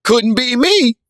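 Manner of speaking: very high, loud catchphrase; imitated reaction-video squeal; mock reaction guy. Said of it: "you can get millions of followers just being like".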